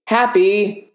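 restrained narrator's roar; mild roar. A narrator's reading. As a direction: call out the name in irritation. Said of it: "Tony roared".

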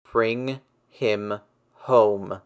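slow, strained rasp over a tinny phone; rasped plea. A narrator's reading. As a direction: drag each word out, barely holding together. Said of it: "May rasped".

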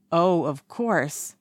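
slow mock-solemn; hammed-up mock seriousness; fake serious. A narrator's reading. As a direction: pretend to be very serious. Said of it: "in a fake serious voice".

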